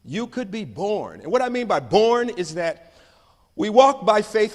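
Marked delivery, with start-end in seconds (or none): 3.6-4.5 fervent declaration, loud; preaching loud. Acted. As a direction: proclaim it like a preacher. Preaching climax.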